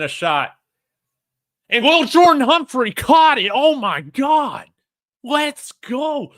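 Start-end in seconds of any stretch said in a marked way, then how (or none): 1.7-6.3 sudden high, loud shout of joy; excited yelling. Real, not acted. Fan screaming as his team scores, high and loud. Fan sees big touchdown catch.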